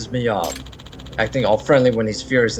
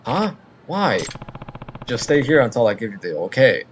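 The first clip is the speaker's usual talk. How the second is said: ordinary talk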